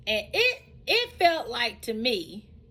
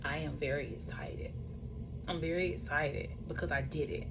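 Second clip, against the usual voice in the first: hushed, breathy with excitement; excited whisper; 4 semitones lower, 10 dB quieter than usual. Real, not acted. She whispers to viewers how excited she is. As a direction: whisper it like a secret thrill.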